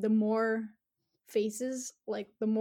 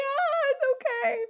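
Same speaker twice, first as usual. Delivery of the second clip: comforting; 14 semitones higher than usual. Retold or imitated. Comforting a crying child.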